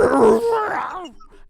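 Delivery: high piercing creature shriek; monster shriek. Acted. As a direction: let out a shrill beast cry. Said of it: "it lets out a".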